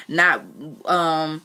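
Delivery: plain reading voice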